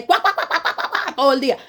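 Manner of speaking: high duck-like quack-quack chatter; mock quacking. Preacher mocks empty boasting as duck quacks, high quacking. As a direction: make endless talk sound like a duck.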